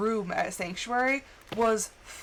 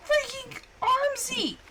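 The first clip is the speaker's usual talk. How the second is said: high, shouted curse; startled shout; 10 semitones higher than usual. Real, not acted. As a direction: yell out in alarm. Sudden enemy attack in game.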